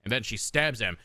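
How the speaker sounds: ordinary delivery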